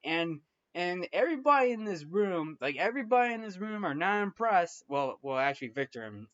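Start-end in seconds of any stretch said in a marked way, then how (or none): none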